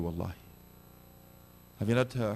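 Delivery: ordinary talk, nothing marked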